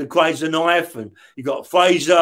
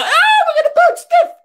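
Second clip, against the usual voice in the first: high, fast mock scream with a laugh; mock terrified squeal, much higher; mocking panic; 6 dB louder, faster than usual. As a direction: shriek it like a panicking inmate, laughing. Mocking screaming prisoners at window.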